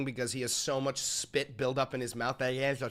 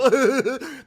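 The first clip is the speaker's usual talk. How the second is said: laugh; 9 semitones higher, 8 dB louder than usual. Real, not acted. Mocking chat fans.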